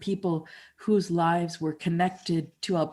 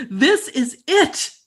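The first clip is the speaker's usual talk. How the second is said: loud, slow, triumphant; ecstatic realization; 9 semitones higher, 10 dB louder, much slower than usual. Retold or imitated. Standing in the rain sure he found nature's secret, loud slow elation. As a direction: savor it like a revelation. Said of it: "And I think".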